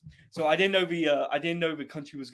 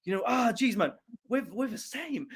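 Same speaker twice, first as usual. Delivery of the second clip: hammed-up solidarity; 5 semitones higher, 5 dB louder than usual. Acted plea of solidarity to Taliban.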